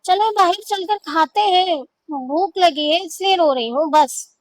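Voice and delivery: exasperated wife brushing him off. A narrator's reading. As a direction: snap it out in irritation. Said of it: "جھنجھلا کر کہا".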